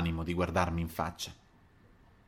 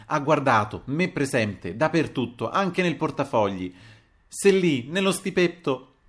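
higher, insistent protest building in force; exasperated outburst; 5 semitones higher, 5 dB louder than usual. A narrator's reading. Adriana contradicts the narrator's story, exasperated. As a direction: press each point, unable to hold back. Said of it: "non poté più reggere".

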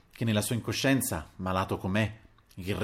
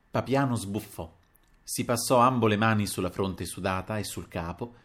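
plain reading voice

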